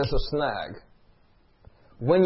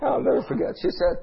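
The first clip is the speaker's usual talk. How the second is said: usual speaking voice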